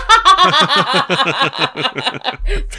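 high, loud burst of laughter; long laugh. Real, not acted. Co-host joking about Bo's DM, real long high laugh. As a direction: crack up and keep laughing. Cracks up at co-host's joke.

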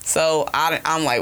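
usual speaking voice

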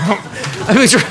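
laughing through words; giggling. Real, not acted. Laughing remembering.

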